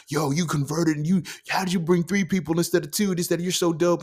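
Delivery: high, loud hyped-up praise; quoting the crowd's hyped praise, high and loud; excited praise. Retold or imitated. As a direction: gush like an impressed crowd. People clapping, praising him.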